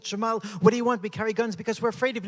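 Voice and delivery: indignant, fast defense of carrying guns; heated retort. Retold or imitated.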